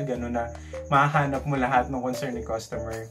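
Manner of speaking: usual speaking voice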